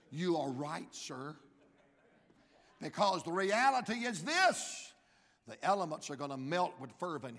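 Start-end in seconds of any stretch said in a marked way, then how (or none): none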